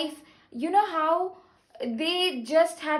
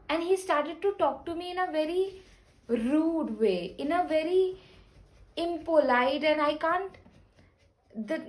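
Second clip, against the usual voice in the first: normal talking voice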